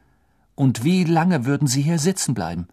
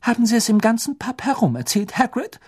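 high, sharp reproach; annoyed; 10 semitones higher than usual. A narrator's reading. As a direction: scold him, pitched up high. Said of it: "sagte Professor McGonagall ungehalten".

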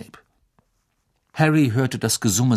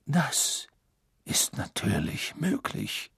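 quiet, slow courteous reply; polite; 4 semitones higher, 7 dB quieter, slower than usual. Acted. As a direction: concede politely. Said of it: "entgegnete Dumbledore höflich".

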